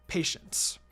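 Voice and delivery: plain reading voice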